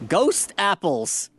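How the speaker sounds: ghost apples drawn out slowly for effect; slow reveal. Real, not acted. Host unveils ghost apples to listeners, drawn out slowly. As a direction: announce it slowly like an eerie reveal. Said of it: "revealing the photo".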